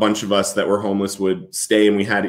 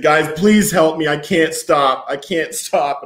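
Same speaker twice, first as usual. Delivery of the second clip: higher-pitched, urgent begging; retold crying plea; 5 semitones higher, slower than usual. Man on his knees interrupting prayer, retold tearful plea. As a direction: re-enact pleading for help, raised pitch. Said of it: "And I'm crying".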